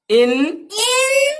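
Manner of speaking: "उन, उन" stretched very slowly and pitched high; drawn-out demo. Shown as a demonstration. Teacher stretching "उन" slowly and high for students. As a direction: sing out each "उन" long and high for the class. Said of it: "dear students".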